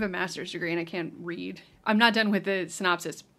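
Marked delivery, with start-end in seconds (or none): none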